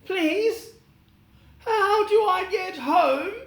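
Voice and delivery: put-on high, pleading little girl voice; young girl asking. Dorothy asking the friendly faces the way home, high and pleading. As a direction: ask it like a lost girl. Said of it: "she asked".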